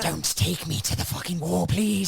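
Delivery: pleading soldier. Retold or imitated. Mock pleading soldier quote.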